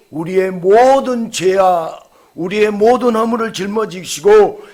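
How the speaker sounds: loud, high, ringing proclamation; full-force preaching. Acted. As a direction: proclaim it to the whole congregation. (힘찬 설교조)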